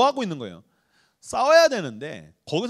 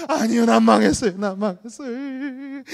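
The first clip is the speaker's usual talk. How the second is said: higher, slow, whining despair; drawn-out lament; 6 semitones higher, 5 dB louder, slower than usual. Retold or imitated. Pastor replaying his cycle of despair, slow and whiny. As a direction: drag out the despair.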